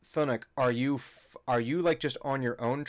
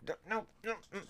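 squeaky voice; 9 semitones higher, 9 dB quieter, much faster than usual. Acted. Character voice from shoulder.

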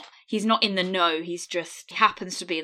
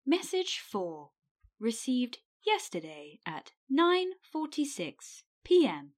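stilted robotic voicemail announcer; word by word, with stepped machine pitch; answer phone voice; 5 semitones higher, 4 dB quieter than usual. Acted. Answering machine reading out message times, robotic. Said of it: "Did you like my answer phone voice".

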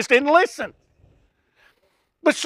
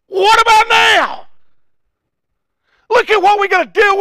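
loud shout; 9 semitones higher, 5 dB louder than usual. Acted. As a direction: hurl the question out. Preacher shouting the people's question.

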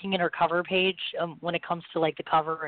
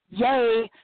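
louder, higher mock "yay"; sarcastic cheer; 5 semitones higher, 5 dB louder than usual. Host jokes about a failing voice before the show, sarcastic cheer. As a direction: fake a cheer nobody means. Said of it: "said nobody ever".

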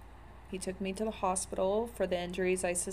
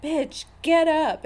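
higher, louder and slow, a harsh yell; retold yelling; 9 semitones higher, 7 dB louder, slower than usual. Friends yell at her passed out on the bed, loud yell. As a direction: bark it down at someone who won't wake. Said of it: "yelling at me".

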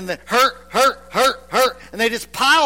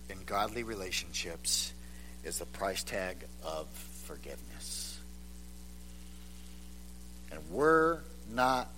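quiet heartfelt sermon delivery; hushed preaching; 10 semitones lower, 12 dB quieter than usual. Acted. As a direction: lean in and speak softly to the congregation. Soft, hushed sermon passage.

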